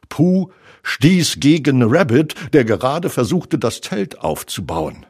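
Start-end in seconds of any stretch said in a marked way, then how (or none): none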